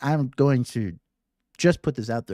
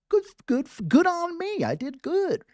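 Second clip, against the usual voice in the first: much higher, gleeful mock self-praise; self-congratulating. Meditator praising herself after a calm sit, high and gleeful. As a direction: squeal a playful pat on the back. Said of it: "You're celebrating yourself like".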